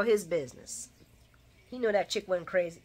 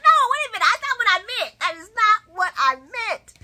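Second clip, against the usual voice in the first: much higher and louder, flustered; mock panic; 12 semitones higher, 7 dB louder than usual. [name] frantically taking back her words, mock panic. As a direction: backpedal fast in alarm.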